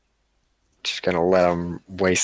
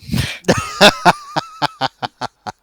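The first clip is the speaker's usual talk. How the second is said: shrill cackling laugh; high-pitched giggle fit; high squealing laugh; 8 dB louder than usual. Real, not acted. Laughs at own joke.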